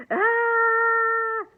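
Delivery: very high, loud shriek; teacher's yell. A narrator's reading. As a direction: scream as she bolts away. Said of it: "yelled the teacher".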